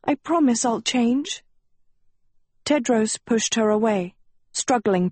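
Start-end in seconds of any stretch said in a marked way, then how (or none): 0.0-1.4 high, ashamed promise; small, pleading voice; tearful whisper. A narrator's reading. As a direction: promise through tears in a higher pitch. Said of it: "Sophie whispered through shamed tears".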